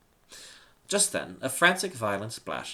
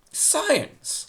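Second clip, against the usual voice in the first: much higher, loud mocking disbelief; laughing scorn; 9 dB louder than usual. Emperor laughs off the warning of sirens, high and loud. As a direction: scoff the word with a laugh. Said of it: "Lysander laughed".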